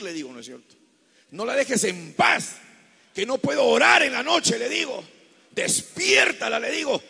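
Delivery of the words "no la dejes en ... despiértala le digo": high, loud pleading, building to shouts; fervent shouted prayer. Retold or imitated. Pastor re-enacting his night prayer to God, fervent shouting. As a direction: cry out demands with long stressed words.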